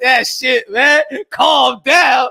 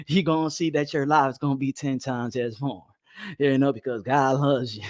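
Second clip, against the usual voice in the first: mellow preacher impression; 7 semitones lower, 6 dB quieter than usual. Boxing streamer mocking a soft-spoken preacher to his chat, low and soothing. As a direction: soothe the congregation in a hushed sermon voice. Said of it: "just like your preacher".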